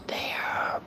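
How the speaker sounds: fake-tender hush on the last word; mock-soothing whisper. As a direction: drop to a hushed whisper. Mock-soothing the upset troll.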